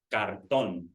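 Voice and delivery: Cartón with the last syllable stressed, tón. Shown as a demonstration. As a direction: say Cartón leaning on the final O. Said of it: "we pronounce the O a little stronger".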